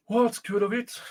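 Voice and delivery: high-pitched, lightly voiced old woman; worried old woman.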